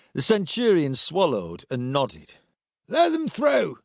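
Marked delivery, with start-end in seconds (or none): 2.9-3.8 high-pitched called order; calling out. A narrator's reading. Centurion calling to soldiers to open the way, high call. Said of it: "he called out".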